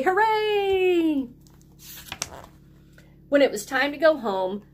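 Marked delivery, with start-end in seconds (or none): 0.0-1.3 put-on high happy "Hooray!" for kids to copy; cheer to join. Shown as a demonstration. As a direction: lead the group cheer. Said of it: "Everybody say".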